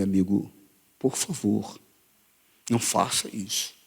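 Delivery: low, soft plea in his ear; hushed, spaced-out private request; ao pé do ouvido. Retold or imitated. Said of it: "falei no ouvido dele". (pedido baixinho, ao pé do ouvido)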